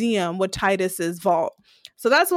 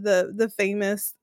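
normal talking voice

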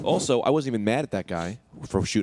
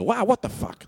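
retold startled yelp, pitched high; startled outburst; 12 semitones higher than usual. Walker reacting to a hose soaking his feet, startled. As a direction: re-enact the shock, voice jumping up.

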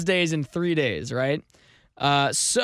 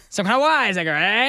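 raised, hammed-up wise-guy heckler; 3 semitones higher than usual. Host doing the voice that he loves, wise-guy voice. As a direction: punch it and end on a cheeky eh. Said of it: "I really just wanted to do the voice".

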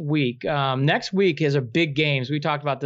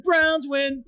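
excited cheer; 9 semitones higher than usual. Pretend. Repeated cheer.

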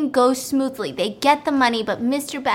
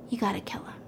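conspiratorial whisper; 4 semitones lower, 13 dB quieter, much faster than usual. Retold or imitated. Wife tells the cop to kill them, whispered. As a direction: breathe the order to kill.

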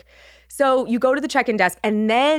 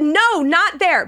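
alarmed nurse; 7 semitones higher, 4 dB louder, much slower than usual. Retold or imitated. Nurse redirecting running patient, retold.

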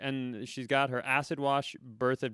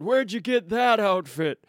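high, loud hammed-up old man; shattered old man; 8 semitones higher, 7 dB louder than usual. Old man whose world has been shattered, loud cartoon torment. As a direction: cry out as an exaggerated old geezer. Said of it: "It's an old man who".

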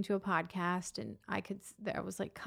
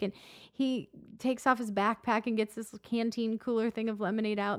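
ordinary talk, nothing marked

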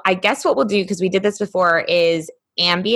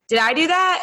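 much higher, mock innocent question; sheepish groom; 10 semitones higher than usual. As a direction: play the oblivious groom, pitched up. Groom scared deer off.

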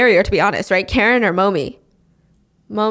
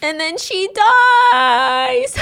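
voice breaking; 11 semitones higher, 4 dB louder, slower than usual. Real, not acted. Telling of grandma's death, voice breaking. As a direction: pitch leaps up on a drawn-out "dies".

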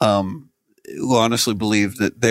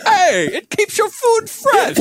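cheerful salesman voice; slower than usual. Acted. Bane salesman continues.

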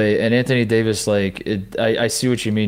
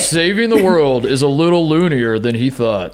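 nineties announcer voice. Retold or imitated. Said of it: "Can you do the whole show in that voice".

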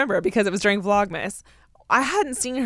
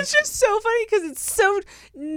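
laughing; 10 semitones higher than usual. Real, not acted. Still laughing hard.

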